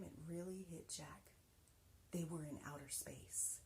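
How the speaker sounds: hushed, breathless narration; awed whisper. A narrator's reading. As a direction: whisper it as if stunned by the view. Awed moment of first seeing space.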